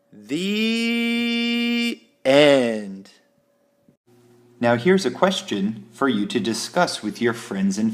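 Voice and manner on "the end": high, held first word, then falling; storybook ending. A narrator's reading. Storyteller finishing the story, high drawn-out sign-off. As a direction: stretch it out high to close the tale.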